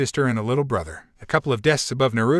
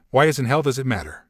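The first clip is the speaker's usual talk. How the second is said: usual speaking voice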